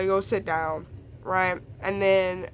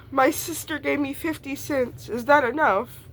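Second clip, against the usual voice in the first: lightly put-on peasant voice, a bit higher; medieval peasant voice; 7 semitones higher than usual. Asking the barista for water like a medieval peasant. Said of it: "Exactly the way I just said it".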